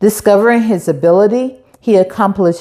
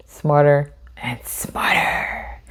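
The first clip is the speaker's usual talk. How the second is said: whispered, softer and slower, trailing off; fading whisper; 6 semitones lower, 8 dB quieter than usual. Pretend. Host fading the repeated word to a playful whisper. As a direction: let the last word melt into a whisper.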